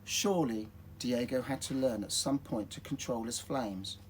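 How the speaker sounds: ordinary delivery, nothing marked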